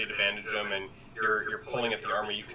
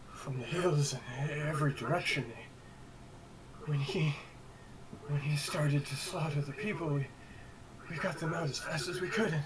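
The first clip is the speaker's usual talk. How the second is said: higher, breathless frightened villager; shaken survivor; 7 semitones higher than usual. Acted. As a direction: stammer it out, rattled. Survivor NPC recounting the attack.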